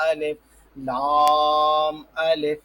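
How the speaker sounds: الف، لام، الف spelled out slowly, each letter apart, لام long drawn out. Shown as a demonstration. Teacher showing students how to spell out the letters, drawn-out. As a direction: say الف، لام، الف as separate letters, never run together. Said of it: "بلکہ جس طرح وہاں پڑھ لیتے ہیں نا".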